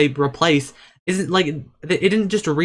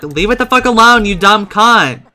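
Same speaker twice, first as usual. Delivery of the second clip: high, loud exasperated outburst; angry scolding; 6 semitones higher, 9 dB louder than usual. Real, not acted. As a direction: yell at the Sim to back off. Scolds Sim over homework.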